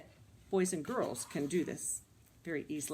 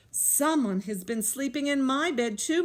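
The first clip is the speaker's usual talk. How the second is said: higher indignant mother bear; crying out, Mama Bear; 6 semitones higher, 4 dB louder than usual. A narrator's reading. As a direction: exclaim it higher, alarmed. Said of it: "Mama Bear cried".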